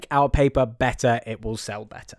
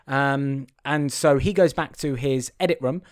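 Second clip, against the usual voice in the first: ordinary talk, nothing marked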